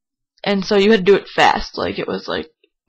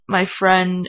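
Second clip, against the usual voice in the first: normal talking voice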